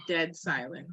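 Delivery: ordinary delivery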